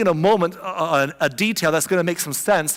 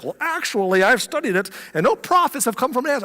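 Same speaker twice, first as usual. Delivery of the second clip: nerdy know-it-all; 7 semitones higher than usual. Retold or imitated. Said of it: "like, a nerd".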